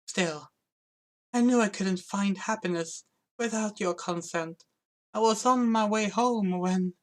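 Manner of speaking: put-on high young prince voice. Voices Edward meeting Anna.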